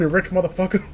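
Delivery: ordinary talk, nothing marked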